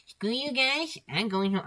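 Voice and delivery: high squeaky cartoon-kid whine; Cartman impression. Mimicking Cartman for a podcast friend, high whine. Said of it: "a really good impression of that".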